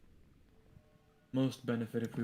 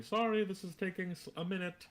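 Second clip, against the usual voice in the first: yelling; 8 semitones higher than usual. Acted. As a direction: shout the apology down the stairs. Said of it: "I yell out to".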